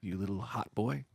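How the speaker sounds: soft, hushed seductive bouncer; a hint of sultry whisper; sexy voice. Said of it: "You're doing a sexy voice".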